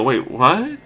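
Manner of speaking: high rising bewildered questions; mock confusion. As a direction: react like a lost viewer, pitch jumping up. Acted confused audience reaction.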